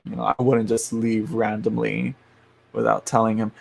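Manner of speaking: ordinary talk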